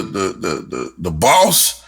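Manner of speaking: normal talking voice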